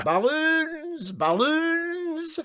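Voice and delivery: high, far-carrying Glasgow barrow call; high Glasgow hawker's call; street vendor cry. A narrator's reading. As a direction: cry your wares down the street. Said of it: "imitating some Glasgow street barrow vendor".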